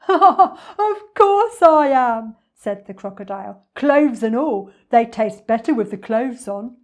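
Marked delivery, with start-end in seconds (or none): 0.1-2.4 loud, high, laughing villain; slow, gleeful villain roar; gloating crocodile. Acted. 3.8-6.8 loud, relishing villain voice; greedy storybook villain; gloating crocodile. Acted.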